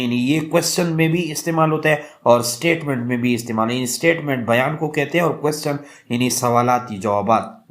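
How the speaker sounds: normal talking voice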